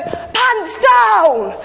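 high, loud playful yell of Pants down; sudden shouted command. Retold or imitated. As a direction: bark it out like a dad springing a game. Dad's sudden game call to kids.

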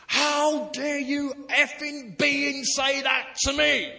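tattooed man's angry slow shout at the pastor; furious outburst. Retold or imitated. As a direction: hurl it back at him, slow and offended. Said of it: "he goes".

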